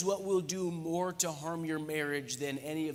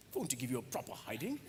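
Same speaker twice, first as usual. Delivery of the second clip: put-on quick British-accented playful threat; mock British accent; 3 semitones lower, 5 dB quieter, faster than usual. Pastor playing with a British phrase for laughs, mock British accent. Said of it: "It sounds kind of British, doesn't it?".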